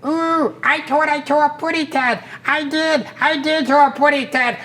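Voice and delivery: high, sweet little bird lisping its lines; high lisping canary; cartoon bird voice. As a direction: chirp it out like a tiny cartoon canary.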